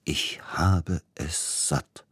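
slow, bitter whisper; quiet, grim. A narrator's reading. Composer at the end of his patience, grimly whispered. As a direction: force each word out under your breath. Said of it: "sagte er leise und verbissen".

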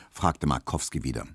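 plain reading voice

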